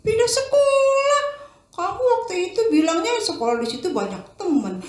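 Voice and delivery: mum taken aback by the news; surprised mother. Acted. Mother surprised her son wants to move, high voice.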